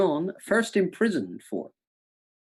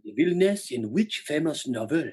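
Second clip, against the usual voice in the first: lower, sultry mock French; French accent; 5 semitones lower than usual. Quiz host retrying the question, low sexy French accent. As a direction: put on a seductive French accent. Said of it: "it's quite, quite sexy".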